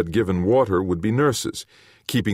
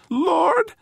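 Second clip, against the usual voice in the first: high, quavering little voice; quavering creature; 3 dB louder than usual. A narrator's reading. Terrified little creature addressing the man, quavering. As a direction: quaver it out in a tiny high pitch. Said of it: "It repeated in quavering common speech".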